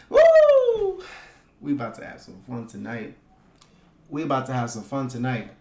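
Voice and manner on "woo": loud, very high-pitched woo; high whoop. Real, not acted. Host cheering on the stream's squad, high whoop.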